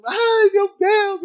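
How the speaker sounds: mock wail. Passengers wailing in panic.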